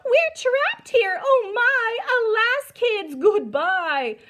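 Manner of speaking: high, loud, slow melodramatic wail of doom; hammed-up terror, saying goodbye to the kids; panicked scream. Miss Greer stranded on the island, wailing loud and high in panic. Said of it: "started to scream".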